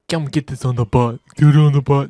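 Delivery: loud, hammed-up imitation of a bossy guard; mock bossy order. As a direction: bark it back at him mockingly. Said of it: "he, he said".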